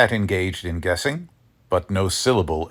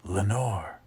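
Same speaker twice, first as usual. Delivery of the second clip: whispered name; 7 dB quieter than usual. A narrator's reading. Narrator calls the lost name into silence, hushed. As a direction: breathe the name softly, almost a whisper. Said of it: "the whispered word".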